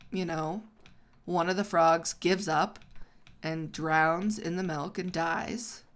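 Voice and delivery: ordinary talk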